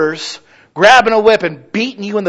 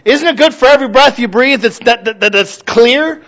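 louder and higher, bursting with gratitude; fervent preaching; 9 semitones higher, 5 dB louder than usual. Acted. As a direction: preach it out, almost crowing with thanks. Preaching with full force.

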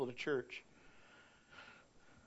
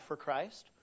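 ordinary talk, nothing marked

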